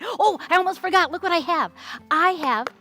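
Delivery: hammed-up excitement; excited reveal. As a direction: build excitement for the surprise.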